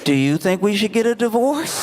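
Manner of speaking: high, rising, tentative acted question; sheepish daughter question. Daughter asks overhearing husband outright, tentative high voice. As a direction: ask it high, climbing to the end.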